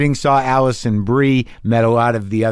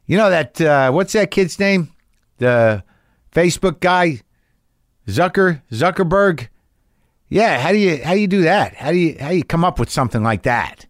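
dad asking about Zuckerberg, mildly voiced; dad's voice; 5 semitones higher than usual. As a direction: lightly play the curious old father. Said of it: "he goes".

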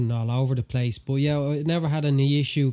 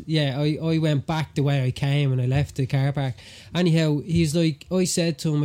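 normal talking voice

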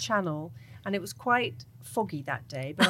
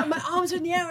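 normal talking voice

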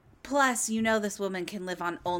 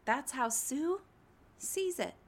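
higher, quieter put-on Sue voice; Sue Sylvester; 5 semitones higher, 6 dB quieter than usual. Sue from Glee summing up the host's view, in a put-on voice. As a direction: voice the scheming cheer coach. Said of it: "That's a Glee reference".

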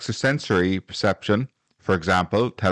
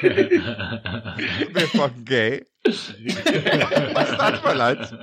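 laughing; much slower than usual. Real, not acted. Cracking up at own joke.

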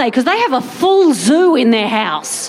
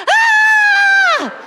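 put-on baby's shriek of delight. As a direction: squeal like a thrilled baby. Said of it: "He goes".

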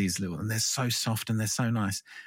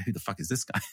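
mock baffled cop. Cop hears the British accent, lightly voiced baffled cop. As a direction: mumble it like a puzzled officer. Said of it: "they hear the voice and they go".

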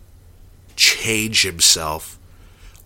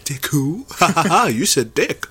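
put-on giggling young actor; 6 semitones higher than usual. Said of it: "He was like".

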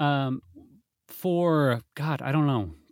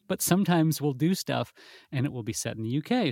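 ordinary talk, nothing marked

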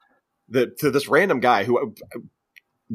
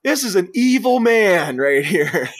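much higher, louder laughing rant; mock yell; 11 semitones higher, 5 dB louder than usual. Party guest warns the crowd, loud laughing mock yell. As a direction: shout a warning to everyone. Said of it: "he's like".

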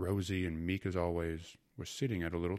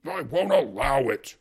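much louder, slow furious warning; angry repeat; 7 semitones higher, 13 dB louder than usual. A narrator's reading. Husband banging the table at the uncle, furious and loud. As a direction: pound each word like the fist. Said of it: "repeated Fyodor, banging on the table".

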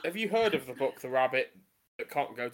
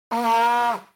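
loud sneeze; 8 semitones higher, 9 dB louder than usual. Real, not acted. Second sneeze, teeth vibrated.

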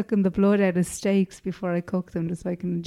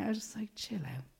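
whispered aside; 14 dB quieter than usual. Retold or imitated. Mock aside to friend, whispered.

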